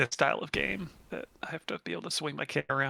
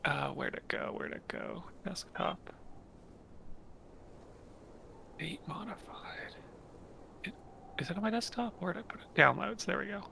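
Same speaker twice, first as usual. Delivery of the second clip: muttering while searching; 4 semitones higher, 11 dB quieter than usual. Real, not acted. Searching files on his desktop.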